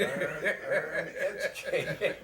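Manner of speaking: slow, laughing slogan pitch. Real, not acted. Host jokes a truck slogan, laughing. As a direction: sell the slogan through a laugh.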